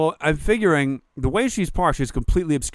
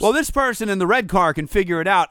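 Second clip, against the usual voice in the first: higher, louder mocking voice; mock careless parker; 6 semitones higher, 5 dB louder than usual. Storyteller voices the selfish parker's reasoning, mocking. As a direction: mimic someone selfish, pitched up.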